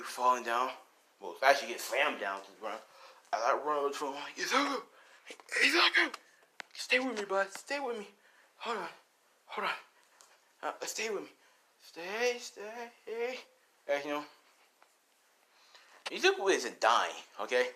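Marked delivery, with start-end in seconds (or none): 4.4-6.1 high, rising acted cry to a fallen friend; desperate high-pitched calling. 6.7-13.4 high, soft acted plea; thin high pleading, trailing softer. Pleading with injured friend.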